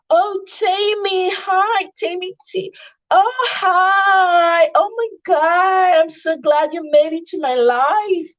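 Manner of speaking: very high, gushing fake-friendly voice; foreign accent impression. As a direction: squeal it like an overexcited socialite. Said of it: "Marty ma sọ òyìnbó".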